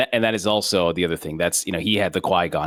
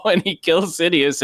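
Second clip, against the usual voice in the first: normal talking voice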